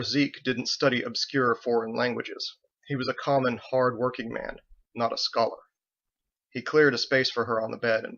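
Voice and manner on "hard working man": usual speaking voice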